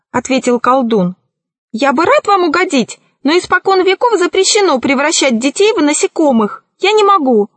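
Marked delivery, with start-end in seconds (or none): 1.7-7.5 high, quick excuse-making; apologetic sorcerer. A narrator's reading. Narrator voices the sorcerer.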